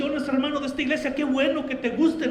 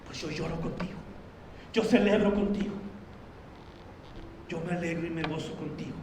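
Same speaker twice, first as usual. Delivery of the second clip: God's tender reply; 7 semitones lower, 8 dB quieter, faster than usual. Pretend. God answers the believer.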